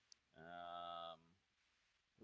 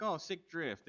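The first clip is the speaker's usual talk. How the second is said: mock call-out, higher and louder; retold yell; 7 semitones higher, 5 dB louder, slower than usual. Yelling praise at the drifting ranger, light retold shout. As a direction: shout it across the street at her. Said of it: "and I yell it to her".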